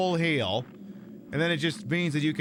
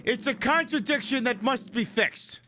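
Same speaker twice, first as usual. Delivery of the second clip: indignant rant; 9 semitones higher than usual. Real, not acted. Indignant rant about play order.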